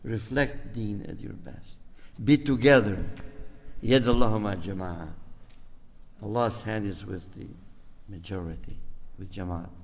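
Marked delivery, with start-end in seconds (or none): none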